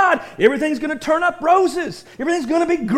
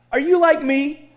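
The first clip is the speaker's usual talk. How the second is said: ordinary talk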